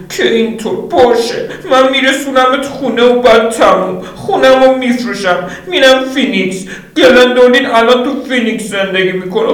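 high voice, cold and done; Lydia, fed up. Acted. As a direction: announce she's leaving him. Lydia's angry decision.

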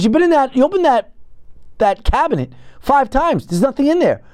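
mock exasperated wife; wife's exasperated voice. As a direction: mimic her exasperation. Wife scolds him over cabinet.

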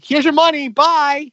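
high, loud mock toss-off; mock exclamation. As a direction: call it out high and brisk, imitating her. Mimics her throwing money.

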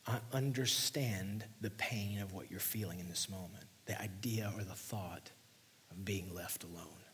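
hushed, gentle comfort from Jesus; Jesus soothing grieving disciples in a whisper; tender whisper. Acted. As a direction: whisper it like consoling a friend.

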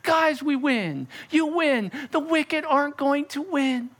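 high, exasperated reassurance; lightly voiced Jesus laughing at the worry; amused Jesus. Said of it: "And Jesus up there going".

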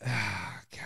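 ordinary talk

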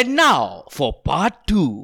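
slow, drawn-out part-two announcement; announcer tone. Pretend. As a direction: announce it slowly with mock drama. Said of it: "It's your tone".

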